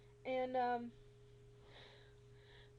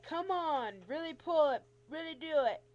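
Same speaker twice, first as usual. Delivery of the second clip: loud, higher, insistent commands; pushy urging; 4 semitones higher, 8 dB louder than usual. Retold or imitated. Doctor urging patient to pull the belt, loudly insistent. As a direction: imitate someone pressing you to try harder.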